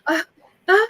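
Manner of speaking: lightly voiced flustered wife; grateful woman. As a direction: gush thanks, then remember the kids. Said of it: "she's like".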